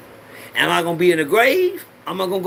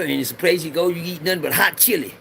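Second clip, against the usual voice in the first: normal talking voice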